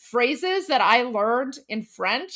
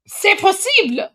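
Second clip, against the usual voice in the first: mock cheerful; 7 semitones higher, 6 dB louder than usual. Echoing the show's pineapple.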